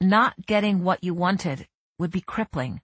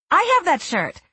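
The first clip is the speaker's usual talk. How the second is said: excited; 12 semitones higher, 5 dB louder than usual. A narrator's reading. Assistant chimes in eagerly.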